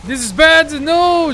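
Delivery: loud, high dismay; alarmed cry. Real, not acted. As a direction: shout it as the round collapses. Losing the round in game.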